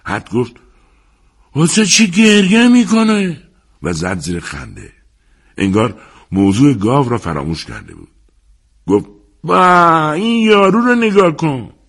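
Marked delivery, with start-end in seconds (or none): 1.5-3.4 high, loud, slow jeering question; hooting at a crying fighter; mocking shout. A narrator's reading. Hat mocks the crying boxer. 9.4-11.7 high, loud, slow scornful call; jeering. A narrator's reading. Hat laughs at the boxer.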